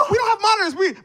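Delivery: high, loud mock panic; frantic warning that breaks off mid-thought; panicked alarm. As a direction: blurt it out in a comic panic. Retold panic cut off by cue.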